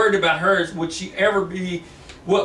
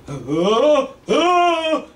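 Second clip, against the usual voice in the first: two strained cries rising higher and louder; pained gasps; 7 semitones higher, 4 dB louder than usual. Acted. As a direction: gasp as strength drains away. James feels weakness, fading.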